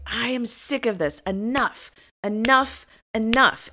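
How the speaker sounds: high, emphatic retold outburst; animated anger. Woman retells being fed up with herself, angrily animated. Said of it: "really getting animated about it".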